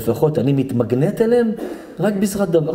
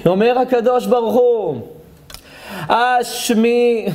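high, drawn-out declaiming; preaching proclamation; 9 semitones higher, much slower than usual. Acted. Rabbi begins quoting God to the congregation, proclaiming. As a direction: proclaim the verse to the whole room.

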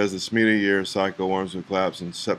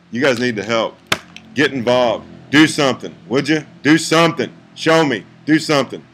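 building louder and higher to an exasperated shout; pleading, then demanding they act; frustrated plea; 3 semitones higher, 7 dB louder than usual. Real, not acted. Vlogger urging viewers to get involved, exasperated rising shout. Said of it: "I'm just frustrated".

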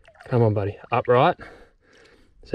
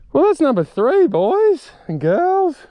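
very high, loud sing-out; triumphant announcement; 4 dB louder, slower than usual. Real, not acted. Angler telling boys and girls of number three, triumphant. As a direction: crow it to your audience, stretching the end. Announces third catch to viewers.

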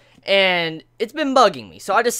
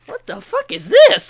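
much higher and louder, a disbelieving cry; shocked outburst; 8 dB louder than usual. Retold or imitated. Vlogger reliving opening the upside-down manga, shocked outburst. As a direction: blurt it out like you just found the pages upside down. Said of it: "and I'm like".

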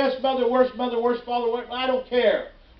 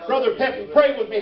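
ordinary talk, nothing marked

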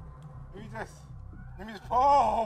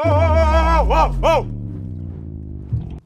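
alarmed whoa; 7 semitones higher, 8 dB louder, much slower than usual. Real, not acted. Boat wobbling, alarmed.

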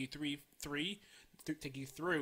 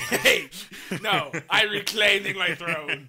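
loud, slow, high proclamation; laughing, hammed-up shout; mock-indignant shout; 6 semitones higher, 17 dB louder, slower than usual. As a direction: declare it like a pretend monarch. Mock fight over the podcast intro.

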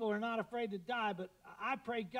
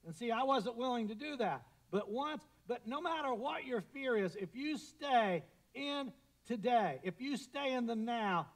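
raised, insistent preaching voice; 5 semitones higher, 5 dB louder than usual. Acted. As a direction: lift it to the pulpit, higher and louder. Preacher exhorting the congregation.

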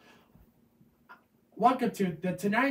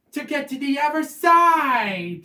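punchline; 5 semitones higher, 8 dB louder than usual. Pretend. Kid delivering joke punchline.

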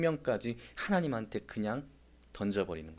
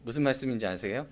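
ordinary delivery

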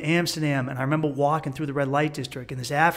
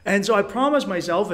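ordinary delivery

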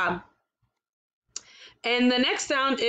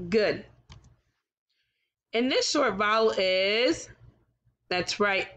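usual speaking voice

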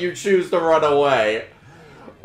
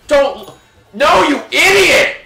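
high, loud furious shout at a teammate; angry yell; 9 semitones higher, 4 dB louder than usual. Real, not acted. Gamer yelling at a careless teammate, furious shout. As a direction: snap at the idiot who's leaving.

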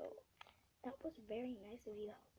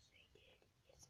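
whisper; 26 dB quieter, faster than usual. Real, not acted.

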